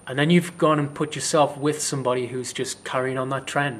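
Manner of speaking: ordinary delivery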